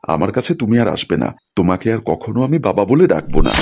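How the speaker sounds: usual speaking voice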